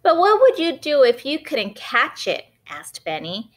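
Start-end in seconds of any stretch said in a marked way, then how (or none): 0.0-2.4 put-on cheeky little boy, higher and louder; Benny's voice. Boy Benny testing his mom, cheeky and high. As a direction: push her with a teasing question.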